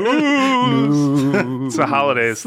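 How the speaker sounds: long eerie ghostly moan. Pretend. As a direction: stretch the word like a haunting ghost. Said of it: "Made it sound scary".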